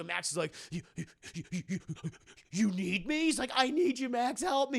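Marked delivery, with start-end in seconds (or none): 0.7-3.2 mock awestruck stammering; disbelieving Electro. Electro stunned at being needed. 3.5-4.8 high-pitched mock begging; hammed-up cry for help; desperate pleading. Harry begs Electro again.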